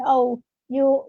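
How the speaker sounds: ordinary talk, nothing marked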